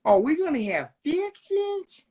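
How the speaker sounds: high-pitched mock excitement over fixings; imitating Stevie's boss. Retelling his sidekick story to the co-host, high impersonation. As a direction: imitate him, pitching it well up. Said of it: "And then he was like".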